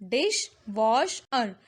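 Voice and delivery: dishwasher slowly as dish, wash, er; syllable by syllable. Shown as a demonstration. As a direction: break dishwasher into three beats. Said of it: "these are the three syllables".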